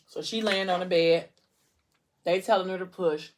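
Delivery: ordinary delivery, nothing marked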